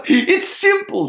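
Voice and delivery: very high, loud little sparrow. Acted. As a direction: answer in a high bird voice. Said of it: "says the sparrow".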